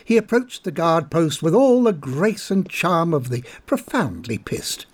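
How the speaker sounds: ordinary delivery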